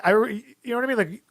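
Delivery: usual speaking voice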